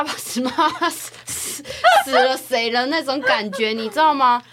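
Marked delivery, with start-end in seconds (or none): none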